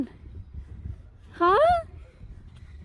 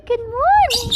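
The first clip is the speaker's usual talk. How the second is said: ordinary delivery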